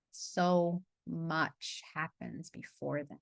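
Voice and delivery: slow soft emphasis. Shown as a demonstration. Coach repeating her point to the group, very slow and soft. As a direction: drag it out gently so it lands. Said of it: "line repeated for emphasis".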